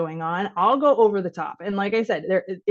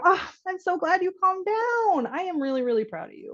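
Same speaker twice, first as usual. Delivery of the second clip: tender praise; 11 semitones higher than usual. Shown as a demonstration. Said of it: "he comes in and go".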